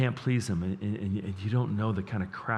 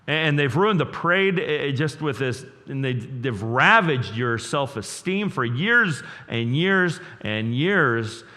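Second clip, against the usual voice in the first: preaching at full force; 4 semitones higher, 5 dB louder than usual. Acted.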